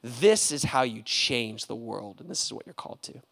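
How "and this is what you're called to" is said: ordinary talk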